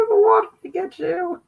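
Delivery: high, fast spooky voice; hammed-up monster threat; mock spooky. Spooky image, mock fear.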